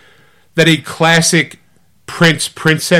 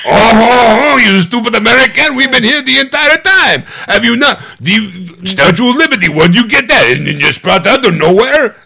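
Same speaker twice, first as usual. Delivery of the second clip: high, indignant mock French accent; french chef; 9 semitones higher, 3 dB louder than usual. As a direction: scoff at the American in French accent. Plays an indignant French local.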